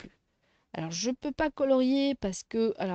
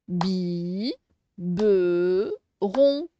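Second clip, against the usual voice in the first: "Bi, be, ron" in separate held beats; low held "Bi" and "be", then a short "ron"; syllable by syllable; 5 semitones lower, much slower than usual. Shown as a demonstration. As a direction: pause after each of "Bi", "be", "ron". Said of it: "on fait toujours les syllabes".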